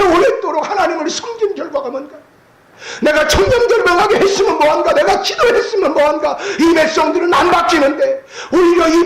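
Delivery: high, loud anguished cry; anguished lament. Acted. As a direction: pour out a broken heart, almost shouting. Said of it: "사무엘의 마음이 아마 다 뭉그러졌을 거예요".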